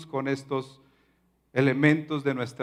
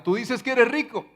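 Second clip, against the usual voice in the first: higher, firm rebuke to the church; voicing the Lord's rebuke; 8 semitones higher than usual. The Lord rebukes the church, higher-pitched and firm. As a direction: speak as the Lord calling out the church. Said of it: "como diría nuestro Señor en Apocalipsis".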